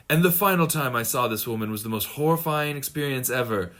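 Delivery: dramatic narrator voice, laughing through it; mock documentary narrator. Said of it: "it's like".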